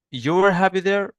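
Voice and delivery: "You were happy there" pitched high with a questioning lift; statement as question. Shown as a demonstration. Teacher showing how English asks without inversion, high questioning tune on "You were happy there". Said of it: "you mean that as a question".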